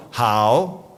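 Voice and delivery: "hao" in Mandarin, not the English "how". Shown as a demonstration. Speaker showing the audience the correct Mandarin "hao". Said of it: "let's try it again".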